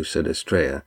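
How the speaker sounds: plain reading voice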